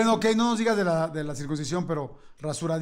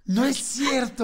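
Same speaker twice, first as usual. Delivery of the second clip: high, drawn-out astonishment; amazed, slow exclamation; real disbelief; 6 semitones higher, much slower than usual. Real, not acted. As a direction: stretch it out, scandalized. Listener reacts in disbelief.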